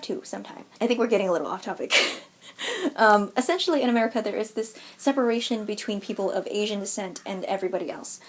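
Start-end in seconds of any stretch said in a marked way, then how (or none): none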